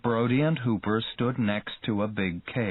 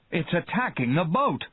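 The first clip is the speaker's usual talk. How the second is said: alarmed cry; 6 semitones higher than usual. A narrator's reading. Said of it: "cried Brody".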